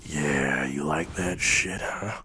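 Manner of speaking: low, slow mock-sexy tease; hammed-up low sleazy taunt; mock seductive. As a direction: play it sleazy for laughs. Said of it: "This is getting weirdly sexual".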